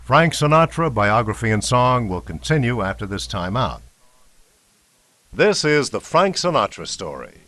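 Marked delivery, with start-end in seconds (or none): none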